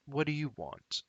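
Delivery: suspicious guard barking a question; gruff goblin guard. Acted. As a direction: challenge the strangers at the door. Goblin guard challenges visitors.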